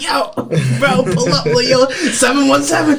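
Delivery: hammed-up alarm, calling it in over the radio; panicked radio call. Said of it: "So I'm, I'm freaking out".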